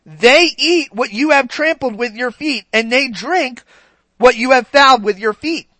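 solemn, emphatic Bible reading; scripture reading. Acted. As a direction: read the rebuke out to the flock. Reading Ezekiel to congregation.

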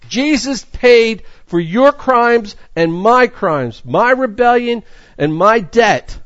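slow, weighty emphasis; solemn slow sermon line; slow preaching. Real, not acted. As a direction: lay each word down slowly. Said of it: "solemn emphatic slow preaching".

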